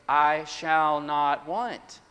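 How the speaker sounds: slow assurance. Acted. The people trusting their shepherd, slowly assured. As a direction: stretch every word with settled confidence. Acted people's calm conclusion.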